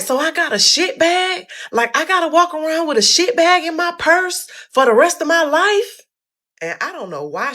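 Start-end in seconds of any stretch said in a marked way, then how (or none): 0.0-6.0 very high, loud, freaked-out shriek; flipping out. Retold or imitated. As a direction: panic over the shit bag in a high squeal. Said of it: "I instantly go to flipping out".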